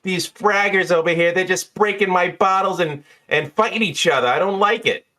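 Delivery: high-pitched, exasperated bartender; Jeannie. Acted. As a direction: complain in a high character voice. Game master plays bartender.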